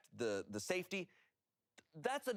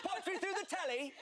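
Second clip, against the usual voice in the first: much higher, laughing, mock-English; British accent. Mocking UK fans, laughing in a put-on British accent. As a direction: say telly like a hammed-up Brit. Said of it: "For the bad accents".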